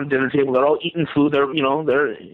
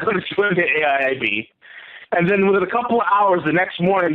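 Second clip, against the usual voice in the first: usual speaking voice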